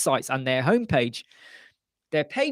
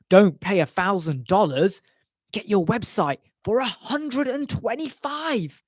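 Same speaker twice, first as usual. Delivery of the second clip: advert voice; 5 semitones higher than usual. Retold or imitated. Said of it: "there was an advert. It said".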